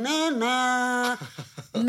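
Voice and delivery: high, loud, long-held call; drunken calling out. Retold or imitated. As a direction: imitate yourself drunkenly hollering for a friend. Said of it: "mens jeg kalder på Nynne".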